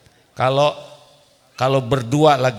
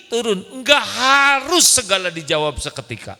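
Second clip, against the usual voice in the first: ordinary talk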